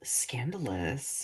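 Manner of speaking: slow, hushed gossip whisper; mock scandalized whisper. Reacting to neighbor dog drama.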